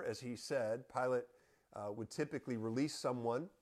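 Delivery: ordinary talk, nothing marked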